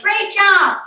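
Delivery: cheery instructor. Retold or imitated. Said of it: "And the gal's like".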